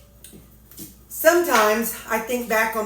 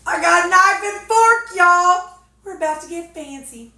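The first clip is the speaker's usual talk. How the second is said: excited; 8 semitones higher, 6 dB louder than usual. Real, not acted. Excited about eating fancy.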